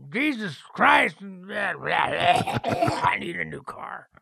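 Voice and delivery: hammed-up high shout, easing as it slows; mock boss rant. Record company boss begs for a hummable song, mock shouting. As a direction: parody a desperate executive yelling.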